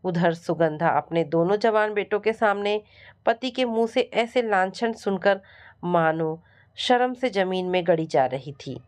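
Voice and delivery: ordinary delivery, nothing marked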